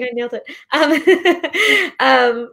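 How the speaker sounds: a pleased "I nailed it" with a laugh through it. Real, not acted. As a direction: laugh out the words in happy relief. Laughing after getting the name right.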